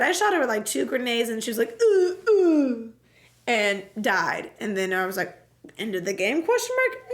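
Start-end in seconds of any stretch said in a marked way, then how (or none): 1.8-2.9 two high, falling wails; mock dying groans. Alexia hit by two grenades, mock dying wails. 5.8-7.0 mock tentative question. Player wondering if game is over, mock tentative rise. Acts own hopeful question.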